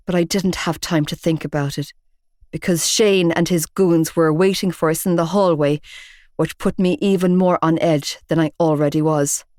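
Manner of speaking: ordinary delivery, nothing marked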